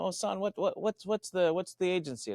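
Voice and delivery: put-on nice old man. Retired lawyer asking speaker about the agency, lightly voiced old man. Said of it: "this eighty-year-old real nice man".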